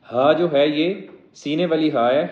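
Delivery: ordinary delivery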